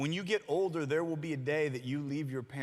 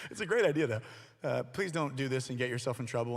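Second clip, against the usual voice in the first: ordinary delivery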